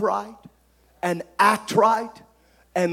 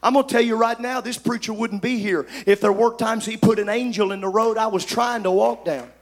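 usual speaking voice

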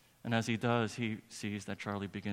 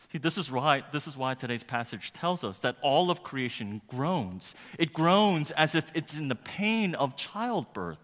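raised, forceful pulpit delivery; impassioned preaching; 4 semitones higher, 7 dB louder than usual. Real, not acted. Preacher proclaiming today's passage to the congregation, impassioned preaching. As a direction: proclaim it, drawing out the weighty words.

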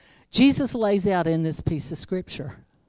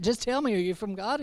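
ordinary talk, nothing marked

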